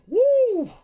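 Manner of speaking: excited whoop. Real, not acted. Celebrating contracts.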